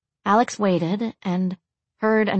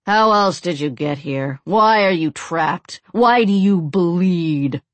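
loud, slow, accusing questions; menacing male captor; 4 dB louder, slower than usual. A narrator's reading. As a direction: interrogate the victim, drawing out each question. Male captor taunts his whipped victim.

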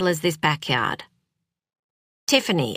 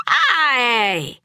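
sharp loud ouch; pained yelp; 6 semitones higher, 4 dB louder than usual. Acted. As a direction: yelp from a sudden pinch. Pinched on the knee.

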